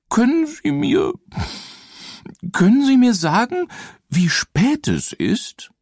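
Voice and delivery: high, hesitant ghost voice; weeping ghost. Acted. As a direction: ask it like a timid ghost. Ghost asks the time.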